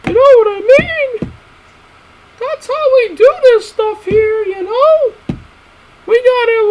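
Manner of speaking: very high, loud squeaky character voice; squeaky high cartoon voice. Vlogger ranting about Superman in a squeaky cartoon voice. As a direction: pitch way up and squawk it like a cartoon critter.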